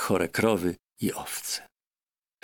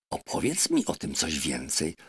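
ordinary delivery

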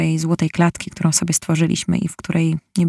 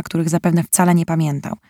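usual speaking voice

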